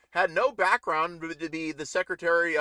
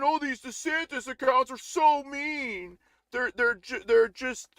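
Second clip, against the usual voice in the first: high, slow whiny mock voice; mocking Tucker Carlson; 7 semitones higher than usual. Streamer mocking Tucker Carlson, whiny and high. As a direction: drag it out like a bewildered pundit. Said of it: "says Tucker Carlson".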